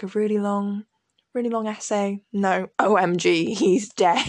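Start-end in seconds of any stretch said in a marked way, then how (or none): 2.3-4.3 slow, loud deadpan; mock blunt announcement. Mocking her child self's diary, a loud blunt deadpan. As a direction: say it flatly like shocking news.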